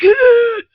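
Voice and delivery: very high, loud screech; impaled villain's cry. Retold or imitated. Child acting Vecna falling on the spike, high mock screech. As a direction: screech as if skewered. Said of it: "and it was like".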